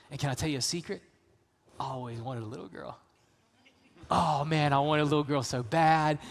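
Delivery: a gentle, hushed father's voice; tender to daughter. Retold or imitated. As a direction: hush it like a secret for your little girl. Father tenderly telling his daughter.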